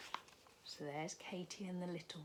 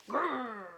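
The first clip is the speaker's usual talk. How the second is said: growl; 7 semitones higher, 8 dB louder than usual. Acted. Bear chasing them.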